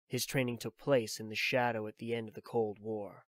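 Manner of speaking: usual speaking voice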